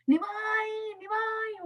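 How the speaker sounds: high, sing-song calling of a name. Acted. Neighbour boy calling to Nimai at the river, high sing-song call. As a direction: call out across the yard, drawn out and high.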